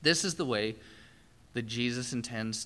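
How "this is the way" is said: usual speaking voice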